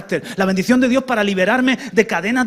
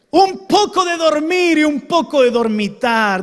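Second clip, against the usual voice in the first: mock sleepy proverb; 7 semitones higher, 5 dB louder than usual. Reading the proverb with emphasis.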